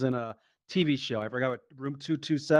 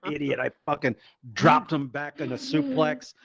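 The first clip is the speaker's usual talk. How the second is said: usual speaking voice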